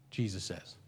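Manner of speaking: ordinary talk, nothing marked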